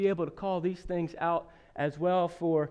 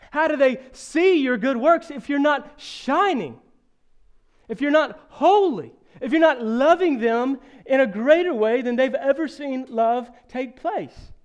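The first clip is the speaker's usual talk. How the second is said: louder, higher fervent challenge; preaching at full force; 6 semitones higher, 7 dB louder than usual. Real, not acted. Preacher pressing the congregation, louder and higher. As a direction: drive the questions home to the church.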